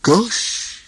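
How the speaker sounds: high wondering gosh; amazed Goofy. A narrator's reading. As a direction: marvel at it, dopey. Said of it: "Goofy looked amazed".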